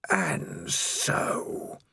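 eerie, slow, whispered menace; breathy voice from the dark; sinister whispering voice. A narrator's reading. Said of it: "a voice said".

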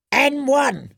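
high, crackly crone bid; old crone bidding. A narrator's reading. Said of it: "said the crone in the violet toga".

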